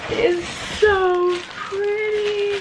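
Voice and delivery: high, very slow swoon; dreamy, drawn-out gush. Real, not acted. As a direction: melt over how pretty it is. Admiring the box contents.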